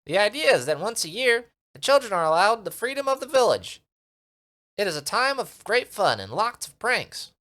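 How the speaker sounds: high, loud little creature voice; eager little creature asking for protection; tiny Minimite creature. Acted. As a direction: squeak it out like a tiny sprite.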